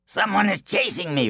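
breathless frightened boaster; scared coward. Acted. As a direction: squeak it out in fright. Boastful man fleeing in fear.

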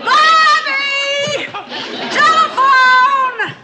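loud drawn-out call across the house; shouting upstairs. Acted. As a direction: yell it up the stairs, stretched slow. Calling upstairs to Bobby.

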